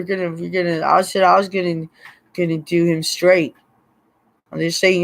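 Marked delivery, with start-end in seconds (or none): none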